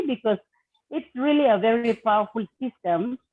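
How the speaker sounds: ordinary delivery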